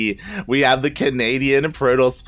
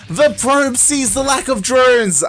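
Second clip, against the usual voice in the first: high, loud, urgent match call; excited play-by-play shout; 9 semitones higher, 5 dB louder than usual. Real, not acted. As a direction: shout the sudden turn to the crowd. Commentator reacts to probe scouting.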